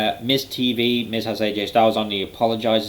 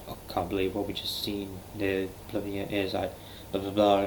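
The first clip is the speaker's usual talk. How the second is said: hushed, grave commentator voice, much softer; mock serious commentary; 8 dB quieter than usual. Mocking the commentators after Orton set the Fiend on fire, hushed and grave. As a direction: parody a solemn injury report, hushed. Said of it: "the super serious talk".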